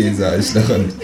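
usual speaking voice

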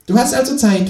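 high, cheeky kangaroo voice. Acted. Reading aloud, the narrator voices the Kangaroo asking him to pay, high and cheeky.

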